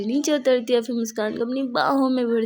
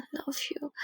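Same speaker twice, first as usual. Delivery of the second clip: whisper; 3 semitones higher, 13 dB quieter, much slower than usual. A narrator's reading. Whispered confession from behind.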